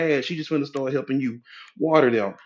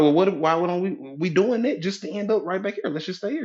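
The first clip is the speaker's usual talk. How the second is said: higher-pitched protesting husband; unexcited husband; 6 semitones higher than usual. Acted. Husband arguing to skip the vacation, high and reluctant. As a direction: make a mock plea against going. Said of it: "your husband is not excited".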